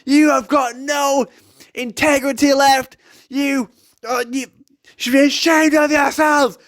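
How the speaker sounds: high, loud mock-angry shouting, slow at the start; hammed-up indignant rant; imitating furious copper. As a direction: mimic the livid copper scolding. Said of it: "he's just like".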